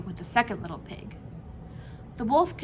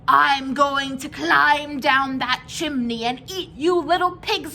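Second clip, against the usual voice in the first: loud, high wolf shout down the chimney; 4 semitones higher, 7 dB louder than usual. A narrator's reading. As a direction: yell it down at the pigs. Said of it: "shouted down".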